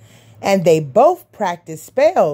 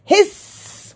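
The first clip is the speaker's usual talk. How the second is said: high hiss; hissing little vampires; 10 semitones higher than usual. A narrator's reading. Six little vampires answering papa with a high hiss. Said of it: "went the six".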